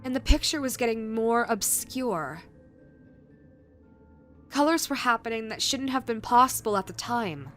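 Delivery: usual speaking voice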